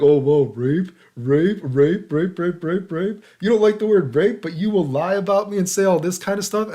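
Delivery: high, loud mocking chant of the word; loud taunting. Taunting the players who banned him, loud and mocking. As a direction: taunt them by repeating it over and over, loud.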